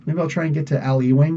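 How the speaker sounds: normal talking voice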